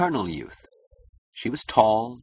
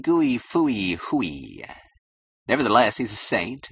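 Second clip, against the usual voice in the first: mock praise rising into singsong rhyme; derisive, exaggerated admiration; flagrant disrespect; 3 semitones higher than usual. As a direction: praise him with open mockery, stretching the words. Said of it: "It was flagrantly devoid of respect".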